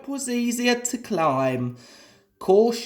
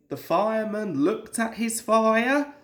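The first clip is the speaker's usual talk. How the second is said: ordinary delivery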